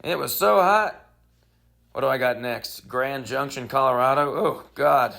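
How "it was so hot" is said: corny joke voice. Shown as a demonstration. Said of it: "so I can go".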